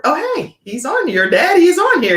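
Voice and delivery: excited discovery. Real, not acted. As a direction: pitch jumps up on spotting him. Spots her dad in the comments.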